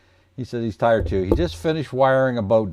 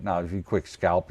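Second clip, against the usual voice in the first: ordinary delivery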